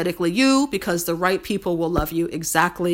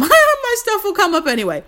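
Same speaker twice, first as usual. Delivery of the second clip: laughing through the words; 13 semitones higher, 6 dB louder, faster than usual. Real, not acted. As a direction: chuckle while you say it. Laughing at own plug.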